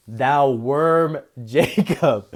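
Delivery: breaking into a laugh mid-verse; slow, amused reading that cracks up. Real, not acted. Preacher amused by the Lord calling Jacob a worm, laughing. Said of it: "I can't even read that without laughing".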